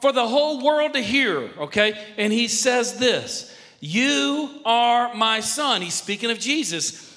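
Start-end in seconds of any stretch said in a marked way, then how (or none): 3.8-5.8 loud, very slow proclamation; God the Father's voice. Acted. God speaking from heaven at the baptism, loud slow proclamation. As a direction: declare it slowly from heaven for all to hear. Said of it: "God the Father's voice came from heaven".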